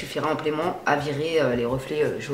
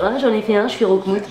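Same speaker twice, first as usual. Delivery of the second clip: higher, louder mock complaint; complaining viewer; 5 semitones higher, 4 dB louder than usual. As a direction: lightly mimic a viewer coming to complain. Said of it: "C'est pas la peine de me dire". (imitation d'une abonnée qui se plaint)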